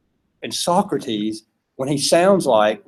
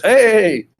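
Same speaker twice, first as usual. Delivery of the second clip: higher, louder goat voice calling hey; goat greeting; 7 semitones higher, 5 dB louder than usual. Retold or imitated. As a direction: play the goat mayor shouting a cheery hey. The goat greets them at the entrance.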